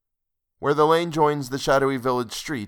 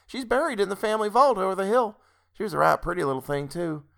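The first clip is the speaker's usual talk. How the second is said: high-pitched country woman; landlord's wife, matter-of-fact; 9 semitones higher, faster than usual. A narrator's reading. Wife telling the doctor about the girl, high and folksy. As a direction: chat in a high rustic voice.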